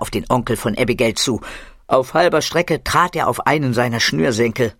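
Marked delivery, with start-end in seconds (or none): none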